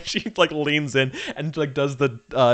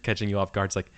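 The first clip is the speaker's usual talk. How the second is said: ordinary talk, nothing marked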